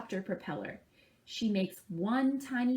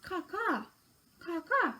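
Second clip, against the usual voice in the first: crow caws; 9 semitones higher than usual. Shown as a demonstration. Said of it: "Let's try it together".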